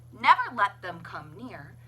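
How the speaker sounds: ordinary delivery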